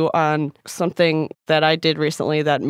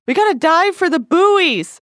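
high, loud, slow sleep-talk shout; sleep yelling; 11 semitones higher, 5 dB louder, slower than usual. Retold or imitated. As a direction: yell it half asleep, dragged out. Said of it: "the sentence I was saying was".

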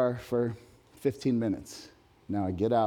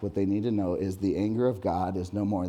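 normal talking voice

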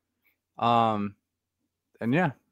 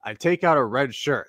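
ordinary talk, nothing marked

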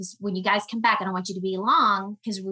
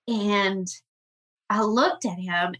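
ordinary talk

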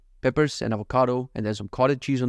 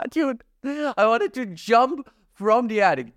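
much higher, slow, laughing through the words; 10 semitones higher, much slower than usual. Real, not acted. Host telling listeners about the attic, laughing.

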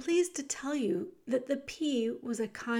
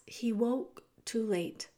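ordinary delivery

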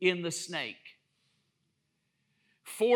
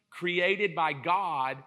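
normal talking voice

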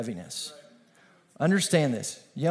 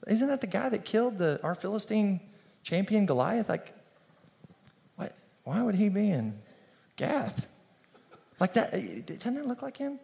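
gossiping Philistines; 4 semitones higher, 5 dB quieter than usual. Retold or imitated. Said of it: "These people are like".

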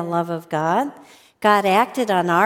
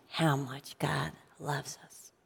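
soft whisper; 7 semitones lower, 13 dB quieter than usual. Real, not acted. Closing point, emotional.

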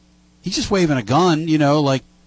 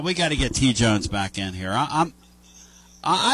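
usual speaking voice

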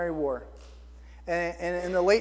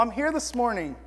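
ordinary delivery

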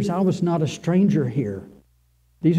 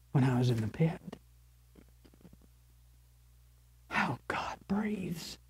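whisper; 10 dB quieter than usual. Acted. Intimate confession of the pit.

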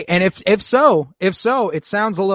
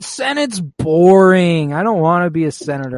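put-on whiny teen; 4 semitones higher, 7 dB louder than usual. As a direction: whine like a bored teenager. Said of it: "Leia's like".

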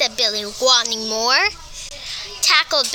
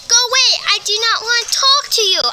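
angry scream; 9 semitones higher, 3 dB louder, faster than usual. Acted. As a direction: go away shouted high and loud, words rushed. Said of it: "I screamed".

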